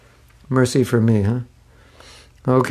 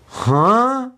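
puzzled huh; 11 semitones higher, 4 dB louder than usual. Retold or imitated. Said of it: "Could you say that in English?".

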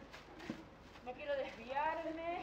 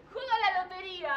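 mocking laugh; 4 semitones higher, 7 dB louder, faster than usual. Laughing at him.

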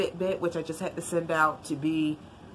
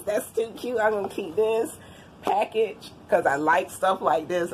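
usual speaking voice

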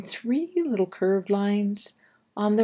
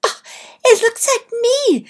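much higher and louder, delighted small mouse; little mouse voice; 9 dB louder than usual. Acted. Imp admiring his drawing, high delighted mouse voice. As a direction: squeak it out like a tiny proud mouse. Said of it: "Imp said".

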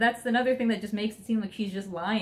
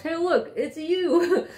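much higher, louder, laughing tease; teasing gods; 9 semitones higher, 5 dB louder, much slower than usual. Retold or imitated. Gods pointing at the cereal box, laughing tease. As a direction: hold up the box and laugh at her. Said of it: "they pick up the box".

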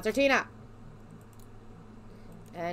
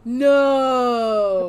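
loud drawn-out no; 10 dB louder than usual. Real, not acted. Refusing guns again.

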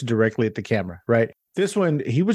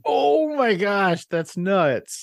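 high, drawn-out astonishment; real amazement; 7 semitones higher, much slower than usual. Real, not acted. Host hearing whom her guest's characters attacked, real amazement. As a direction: stretch the first words high in disbelief. Host reacts to famous actor's name.

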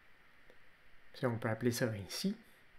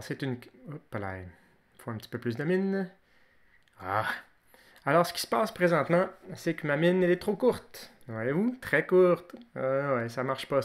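ordinary delivery

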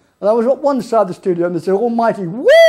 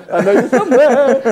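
higher and louder, laughing through it; louder laughing call; 6 semitones higher, 5 dB louder than usual. Retold or imitated. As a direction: call it out to the hiding snakes. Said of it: "walk around going".

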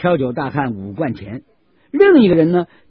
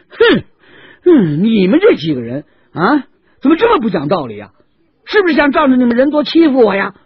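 much higher, loud angry complaint; indignant shout; 9 semitones higher, 7 dB louder than usual. Acted. 挑酒大汉夺回瓢，冲众人怒喊. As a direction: yell at them for ganging up. Seller snatches ladle, furious.

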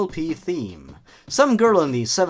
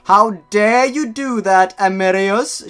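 loud, high, outraged shout; 6 semitones higher, 7 dB louder than usual. Acted. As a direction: shout it at someone who crossed you. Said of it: "shouted Amicus".

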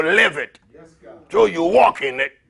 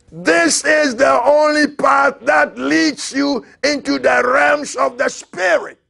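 slower, higher, louder preaching cry; preached louder; 9 semitones higher, slower than usual. Shown as a demonstration. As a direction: repeat it and raise it up to the rafters. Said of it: "repeated louder".

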